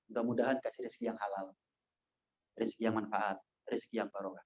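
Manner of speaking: low, soft, unhurried well-wishing; gentle approach. Acted. Preacher gently wishing a labourer lawful earnings, soft and low. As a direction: speak softly and low, as if blessing him.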